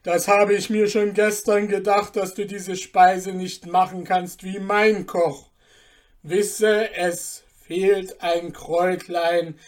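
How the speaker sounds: a guest lecturing the dwarf with scorn; smug stranger. Acted.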